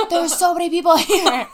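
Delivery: high loud shout, laughing; overwhelmed nerd shouting in a crowd; sniffly nerdy guy. Retold or imitated. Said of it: "He was like".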